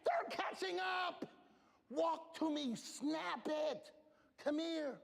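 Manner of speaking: urgent high yell. Retold or imitated. Coach yelling at his runner to cross the line, urgent. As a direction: yell at her to hurry before they catch up. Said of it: "I said".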